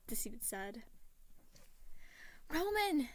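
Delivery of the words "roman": put-on high, loud joyful child shout; excited shout. A narrator's reading. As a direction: call out, thrilled to see him. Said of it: "an excited voice shouted".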